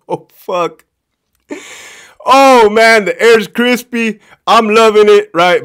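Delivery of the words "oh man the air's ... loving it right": loud, high, delighted gloating; gleeful relish. Real, not acted. Storyteller gloats as the buffoon is put down, loudly gleeful. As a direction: revel in it at full volume.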